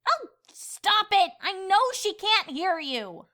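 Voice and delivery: high, loud, slow protest; exasperated. Acted. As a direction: yell at him to stop. Furious at narrator.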